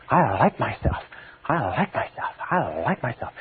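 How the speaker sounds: softly, with growing conviction; mean every word of it; with feeling. Shown as a demonstration. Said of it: "emotionalize it".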